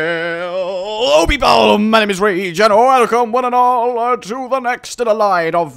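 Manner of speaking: loud, bright show opener; excited host greeting. Acted. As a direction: welcome everyone with big energy.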